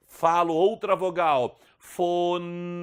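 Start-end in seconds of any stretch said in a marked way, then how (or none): 1.8-2.8 Foné drawn out syllable by syllable, the N held nasal; teacher spells out Foné sound by sound; slow syllable demo. Shown as a demonstration. Teacher building the word for students, drawn-out demo. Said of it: "O ar sai pelas narinas".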